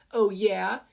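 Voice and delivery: high, loud "oh yeah"; defiant retort. Acted. Mei defying Mina while punched, defiantly. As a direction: fire back in spite of the pain.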